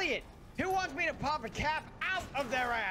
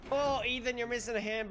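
ordinary talk, nothing marked

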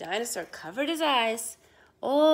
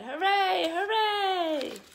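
higher excited hooray; kids' happy cheer; 7 semitones higher, much slower than usual. Acted. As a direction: cheer for the winner. Children cheer the dinosaur.

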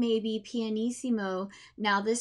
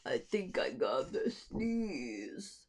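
softer, slow, stuffy-nosed speaking voice; stuffy mezzo voice; 2 semitones lower, 7 dB quieter, slower than usual. Acted. Teacher voicing the hog about to sneeze. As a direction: say it slowly, as if a sneeze is coming. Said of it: "that is probably, like, mezzo speaking voice".

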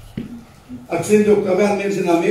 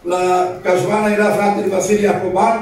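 usual speaking voice

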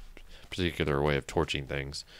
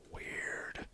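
whispered weird; 12 dB quieter than usual. Real, not acted. Puzzled aside at block behaviour.

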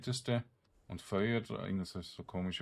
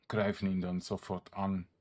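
ordinary talk, nothing marked

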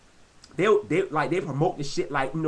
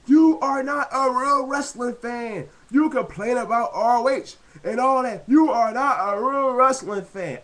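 1 mocking indie fan; 5 semitones higher, 4 dB louder than usual. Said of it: "they were like".

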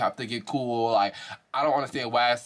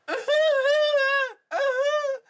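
high shrieking laugh; 4 dB louder than usual. Real, not acted. Vlogger shrieking with laughter at the crying clip. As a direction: burst into a shrill cackle.

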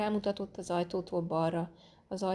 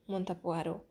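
plain reading voice

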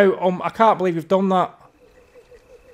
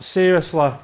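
usual speaking voice

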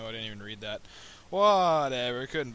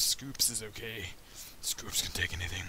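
lightly voiced tough guy boasting; put-on tough voice; 4 semitones lower than usual. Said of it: "why I'm talking like that".

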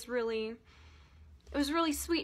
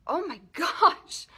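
horrified gasp; 6 semitones higher, 7 dB louder, slower than usual. Retold or imitated. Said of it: "And I was like".

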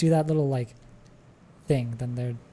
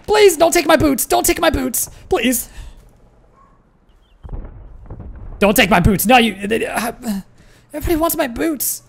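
much higher and louder, hammed-up pleading; mock begging; 9 semitones higher, 5 dB louder than usual. Streamer begging raiders not to take his boots, hammed-up pleading. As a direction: beg like a desperate victim, for laughs.

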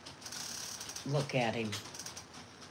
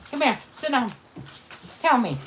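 ordinary talk, nothing marked

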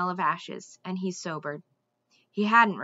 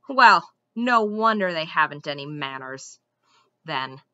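sniffy disdain; 5 semitones lower, 6 dB louder than usual. A narrator's reading. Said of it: "the squirrel sniffed".

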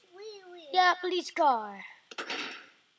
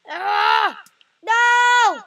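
loud, higher cry of frustration; frustrated shout; 4 semitones higher, 9 dB louder than usual. Real, not acted. Player losing the level, shouting in frustration. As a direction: yell no at the screen.

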